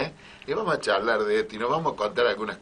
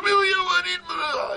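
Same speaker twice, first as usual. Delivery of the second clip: high, shouted mock wail; 3 dB louder than usual. The lady mocking the maid's wail in a high cry.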